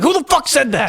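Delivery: high, loud mock outrage; imitated furious outburst; angry shout. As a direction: yell it like challenging a heckler. Acting out film character's angry outburst.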